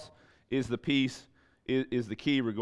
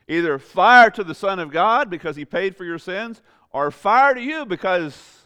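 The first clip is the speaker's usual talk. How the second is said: much higher and louder, almost a shout; full-force preaching; 7 semitones higher, 8 dB louder than usual. Acted. Preacher telling the congregation to light it on fire, shouting. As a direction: cry it out like fire from the pulpit.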